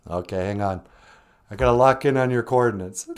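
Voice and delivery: very low put-on operator voice; deep monotone voice on the other end; acted teleport operator. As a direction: drop way down to play the operator.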